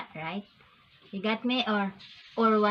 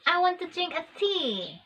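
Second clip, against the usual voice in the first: higher-pitched mimicked British accent; imitated British accent; 6 semitones higher than usual. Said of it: "because the child says".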